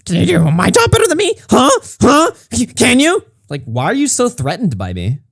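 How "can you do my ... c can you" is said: mock fuming. Streamer mimicking his fuming rival's challenge, mock fuming. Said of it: "He's actually mad".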